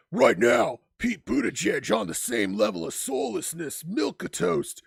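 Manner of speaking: loud hyped wrestling promo. Pretend. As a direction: growl it like a pro wrestler. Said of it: "I'll do it in my wrestling voice".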